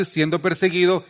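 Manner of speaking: ordinary talk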